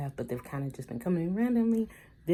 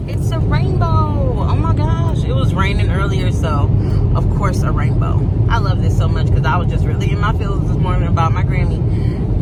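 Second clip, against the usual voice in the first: thrilled, calling it out loud; excited gasp; 3 semitones higher, 12 dB louder than usual. Real, not acted. Seeing a rainbow after rain, loud and delighted.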